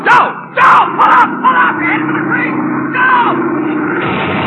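frantic yelling into the radio, very loud and high; desperate shout. Acted. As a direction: scream at him to pull up before he crashes. Pilot about to crash, radio.

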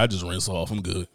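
lazy dude voice. Retold or imitated. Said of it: "be like".

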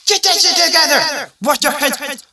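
put-on high, loud frantic parent yelling at the kids. Parent screaming at the kids to get ready for church, high and loud. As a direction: rush the family out the door, barking orders.

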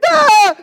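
long, loud, high answering scream; hammed-up panicked shout; frightened kid scream. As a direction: yell in shock as the startled boy. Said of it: "And then I'm like".

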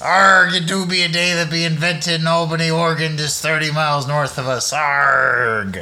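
pirate voice. Acted. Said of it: "Talk Like a Pirate Day".

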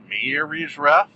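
put-on "Marriage" stretched slowly in a muddled accent; slow, accidental odd accent on "Marriage Ref"; unidentifiable accent. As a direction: slip into an unplaceable accent on "Marriage". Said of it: "I don't know what accent that was".

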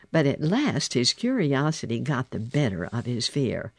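usual speaking voice

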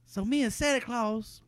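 normal talking voice

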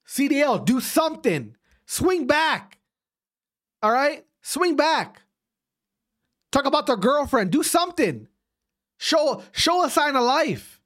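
high-pitched, exasperated urging; pissed-off rant. Real, not acted. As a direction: goad them into fighting back. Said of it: "I'm pissed right now".

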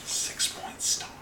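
hushed whisper to stay hidden. Real, not acted. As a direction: whisper it, barely voiced. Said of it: "Why are you whispering?".

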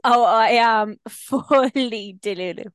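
drawn-out proud admission; playful exaggeration. Real, not acted. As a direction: own it with a slow grin. Cheerful self-mocking admission.

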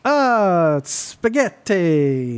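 very slow, stretched-out mock Italian; badly faked Italian accent, dragging the word out. Co-host asks for an accent on the spot, hammed-up Italian. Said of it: "do an Italian accent".

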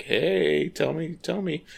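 high, drawn-out mock catcall; men calling out. Men calling to the woman walking home, mock calling out. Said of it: "all these men are like".

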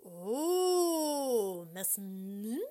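long swooping moan; wailing rec cat.

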